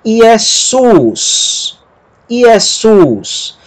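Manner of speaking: Iesus with a long, high final sus; stress on SUS. Shown as a demonstration. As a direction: lean on the end of Iesus. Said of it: "a pronúncia dessa segunda palavra".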